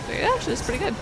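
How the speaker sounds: normal talking voice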